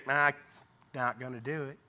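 low, soft, under-the-breath refusal; reluctant refusal. Acted. Preacher acts out you answering God's call, quietly reluctant. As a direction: back away from it quietly. Acting reluctant reply to God.

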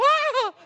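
sudden very high loud yelp of surprise; startled high cry. Retold or imitated. Preacher recognizing the officer, a startled yelp. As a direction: jolt at the surprise.

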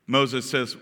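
ordinary delivery

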